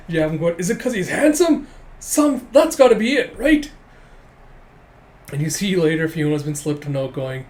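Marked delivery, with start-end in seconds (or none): 0.6-3.7 high, loud incredulous whine; hammed-up outburst, voice shooting upward; mock jealous exasperation. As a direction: demand an answer in comic frustration. Rival's jealous guessing, acted.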